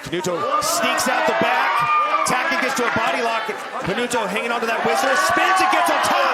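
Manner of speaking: rising, loud excited call; building to a shout; excited play-by-play. Real, not acted. Scramble, commentator calls it.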